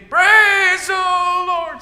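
long, high, ringing shout; screamed praise. Acted. Old lady screams praise, waking the neighbours, long high shout. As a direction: stretch the praise out to the heavens. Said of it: "same thing".

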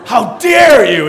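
slow, loud hammed-up outrage; mock indignation. Dad voicing his betrayed baby, slow loud mock indignation. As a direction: stretch it out loudly as a scandalized child. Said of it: "she's just like".

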